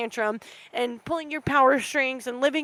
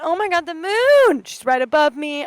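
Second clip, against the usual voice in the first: higher, louder burst of delight; excited about the moon; 4 semitones higher, 7 dB louder than usual. Real, not acted. Podcaster spotting the moon overhead, excited and louder. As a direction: gasp out her wonder at the moon.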